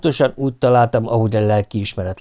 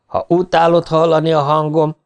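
raised-pitch angry retort; mild yell; 5 semitones higher, 3 dB louder than usual. A narrator's reading. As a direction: half-shout it in a quarrel. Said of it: "ordítottam".

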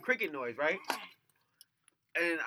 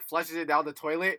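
normal talking voice

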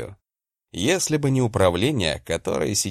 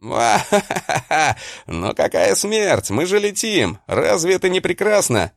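maniacal laugh; 8 semitones higher than usual. Acted. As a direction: cackle it out like a madman. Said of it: "безумно захохотать".

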